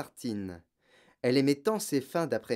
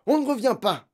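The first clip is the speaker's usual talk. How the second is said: loud, high flat refusal; father snapping loudly; curt shout; 10 semitones higher, 7 dB louder than usual. A narrator's reading. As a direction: shut the question down angrily. Father shouts final answer.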